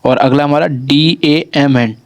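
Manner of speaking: usual speaking voice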